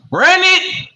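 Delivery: loud, high-pitched shouted cue; Supreme Beast boxing talk voice. Pretend. Host cues up the clip, in a shouted boxing-talk voice. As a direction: belt it out as a hyped boxing show catchphrase. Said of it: "In my Supreme Beast boxing talk voice".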